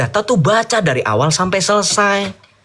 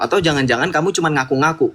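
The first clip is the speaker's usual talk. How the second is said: ordinary delivery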